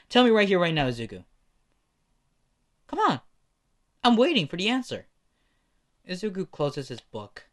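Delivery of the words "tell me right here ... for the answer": high, loud, pushing for an answer; angry demand. Acted. Bakugo demanding Izuku answer him, loud and angry.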